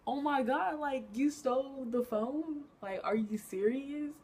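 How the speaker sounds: high, slow, stretched-out disbelief; retelling her shock at the thief; stunned disbelief. Retold or imitated. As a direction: drag it out like you can't believe it. She stole the phone.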